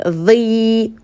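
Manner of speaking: "dhi" with a thick voiced TH and long I; emphatic letter with I. Shown as a demonstration. Teacher isolates it from the verse, long thick "dhi". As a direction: draw out a heavy "dhi". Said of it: "elle a une voyelle I".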